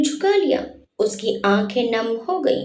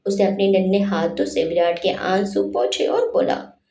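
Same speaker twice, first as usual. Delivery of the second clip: plain reading voice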